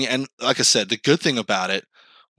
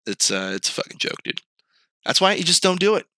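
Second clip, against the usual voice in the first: ordinary talk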